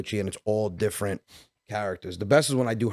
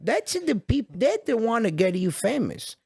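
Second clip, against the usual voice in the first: put-on high, slow old-lady voice; elderly woman; 10 semitones higher, slower than usual. Grandmother urging the comedian to bring his relatives, high and drawn out. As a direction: draw it out as a pleading old woman. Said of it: "She was, like, eighty-five years old".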